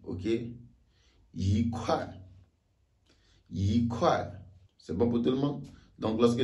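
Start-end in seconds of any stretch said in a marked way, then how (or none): 1.3-2.1 "yi kuai": rising "yi", falling "kuai"; second-tone yi. Shown as a demonstration. Teacher shows fourth-tone case, rising "yi" before "kuai". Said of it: "le i devient automatiquement deuxième ton". 3.5-4.2 "yi kuai": rising "yi", falling "kuai"; second-tone yi. Shown as a demonstration. Teacher shows fourth-tone case, rising "yi" before "kuai". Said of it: "le i devient automatiquement deuxième ton".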